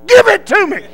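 high, loud military order; commanding yell. Retold or imitated. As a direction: holler for it to be handed over. Officer demands the gum.

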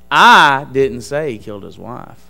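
"I" punched loud at the very start; stress on I. Shown as a demonstration. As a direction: hit the word "I", then let the rest fall away. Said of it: "Where you emphasize the word".

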